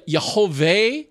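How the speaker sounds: Yehovah said as yeh-ho-VAY, ending in vay. Shown as a demonstration. Preacher offering a possible reading of God's name, Yehovah said yeh-ho-vay. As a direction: end Yehovah on a rising "vay". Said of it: "if it's pronounced".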